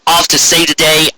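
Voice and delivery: plain reading voice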